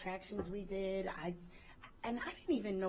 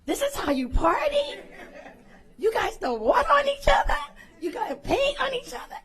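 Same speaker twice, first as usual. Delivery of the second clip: amazed cast; 16 dB louder than usual. Retold or imitated. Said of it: "the cast was like".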